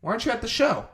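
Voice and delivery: high, startled questions; surprised sister. Retold or imitated. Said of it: "She's like".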